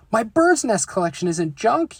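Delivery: high, loud hammed-up yell. Brother yelling at Mama over his bird's nests, loud and high. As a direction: holler it like a cub defending his treasures. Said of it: "yelled brother at the top of his lungs".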